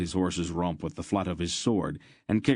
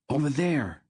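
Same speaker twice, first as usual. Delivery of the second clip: higher urgent cry; 8 semitones higher than usual. A narrator's reading. Boy spotting a monster in the woods, crying out. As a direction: point it out in alarm. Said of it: "Garion cried".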